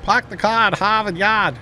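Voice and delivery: high Boston accent impression; exaggerated Boston accent with dropped R's; Sean's Boston accent. Making fun of Sean from Boston, mock Boston accent. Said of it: "Sean's just gonna go on about".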